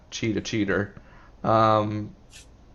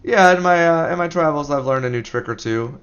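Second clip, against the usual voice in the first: smug showman voice; 6 semitones higher, 7 dB louder than usual. Acted. Character boasting with a flourish.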